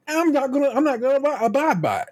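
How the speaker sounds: high, whiny mock voice of a driver; high mocking imitation of a rule-breaker; mock careless driver. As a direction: sneer it out like a selfish driver.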